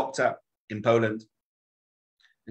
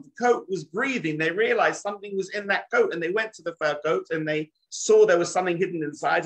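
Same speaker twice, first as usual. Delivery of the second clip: higher, mounting wonder as the coat breathes; rising storytelling excitement; 7 semitones higher than usual. A narrator's reading. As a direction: build the suspense up to the reveal. Builds the story's climax.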